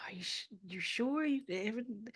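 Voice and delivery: mildly worried, halting and hushed; imitated worried relative. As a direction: gently mimic anxious family checking in. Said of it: "you hear in the voice like".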